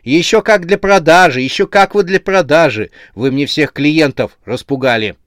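loud, high, emphatic telling-off; cross scolding. A narrator's reading. Saleswoman overrules the protesting heads, scolding crossly. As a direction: snap back at the cheeky goods. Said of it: "Девушка насупилась".

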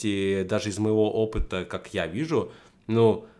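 ordinary talk

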